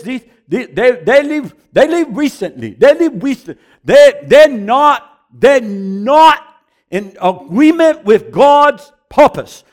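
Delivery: loud, high, halting broken English; imitating the Singapore preacher. Preacher imitating the Singapore man's prophecy, loud and halting. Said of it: "And he says".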